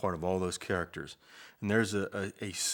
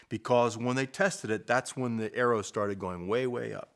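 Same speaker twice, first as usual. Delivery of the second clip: normal talking voice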